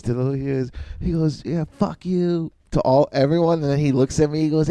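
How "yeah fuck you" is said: slow, higher mock curse; retold heckler. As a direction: imitate a heckler dragging out his insult.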